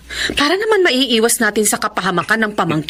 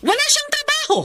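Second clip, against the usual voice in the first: high scolding. Acted. Mother scolds about jobless boyfriend.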